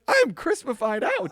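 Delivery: high, playful protest; playful high declaration; mock protest. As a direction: pitch it up, proud of the outfit. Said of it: "I was like".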